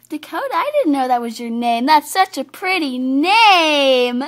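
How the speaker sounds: loud, building enthusiasm; gush, stretching the final word out long; over-excited admiration; gushing compliment. Acted. Colt gushes over the name.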